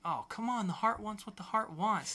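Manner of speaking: high, pleading mock whine; whiny plea. Hank whining to the brothers, high mock plea. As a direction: act out the character's petulant excuse.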